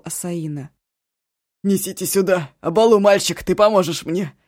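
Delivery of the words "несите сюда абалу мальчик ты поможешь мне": громко, властно зовёт помощника; крикни, распоряжаясь; громкий зов. Acted. (громкий приказ)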